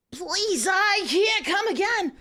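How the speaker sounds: high, slow pleading cry; desperate plea. Acted. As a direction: beg breathlessly. Heroine pleading in scene.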